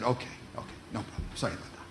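soft unvoiced whisper, low; whispered apology. Wife whispering an apology to husband, hushed. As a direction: sheepishly whisper sorry. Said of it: "and she said".

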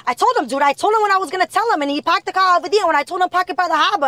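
loud, high Boston rant; angry Boston accent. Retold or imitated. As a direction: imitate friends ranting in Boston accents. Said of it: "When they're angry or whatever".

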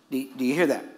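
ordinary talk, nothing marked

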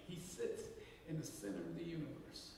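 low, soft and hushed; reverent hush. Real, not acted. Preacher quietly declaring Jesus at the center. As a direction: let it settle, almost reverent.

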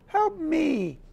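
unicorn crying for help, very high. A narrator's reading. Unicorn pretending to need rescue, high cry for help. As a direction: squeak it out high and pleading. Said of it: "he'd cry".

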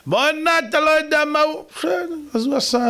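high, whiny mimicry of a bickering spouse; mock quarreling spouse. Preacher mocking a bickering couple to his audience, high whiny quarrel voice.

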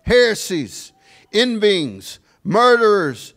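high, punched preaching cadence; forceful scripture reading. Real, not acted. Preacher reading the list of sins to the congregation, forceful declaiming. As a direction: ring out each item separately, falling at its end.

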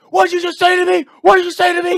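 very high, loud enraged shout; furious yell imitated. The kid screaming at the teller, very high loud rage. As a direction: scream it like a drunk losing it. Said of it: "He loses it".

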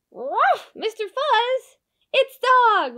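high, loud startled alert; cartoon alarm. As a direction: warn in a panic. Cat alarmed by noise.

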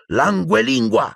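high, slow, drawn-out incantation; commanding spell. Acted. Harry aims his wand at Peeves, commanding. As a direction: cast it as a firm order. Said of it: "e ordinò".

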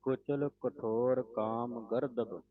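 slow, careful recitation; slow model reading. Shown as a demonstration. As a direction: spell each word out slowly. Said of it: "teacher models the line".

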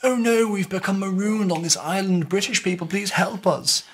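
high-pitched posh English plea; hammed-up posh accent, pitched right up; really posh. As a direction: play a posh castaway begging for help. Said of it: "make them pretend to be really posh".

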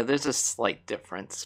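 ordinary talk

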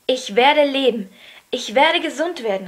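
loud, high, jubilant declaration; exultant cry. A narrator's reading. Colin in the garden believes he will recover, exultant. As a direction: cry it out with full conviction. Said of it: "rief er".

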